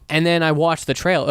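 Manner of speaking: ordinary talk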